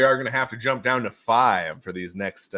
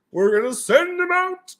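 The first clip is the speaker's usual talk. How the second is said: high, slow, louder silly call; hammed-up announcement; 5 dB louder than usual. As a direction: stretch it out in a high put-on voice.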